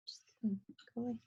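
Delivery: whispered aside to herself; whispering. Real, not acted. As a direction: breathe it as an unvoiced whisper. Aside while fixing the screen.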